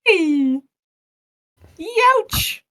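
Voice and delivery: high, loud cartoon ouch; pained yelp. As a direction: yelp like you got hit. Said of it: "make a dying sound".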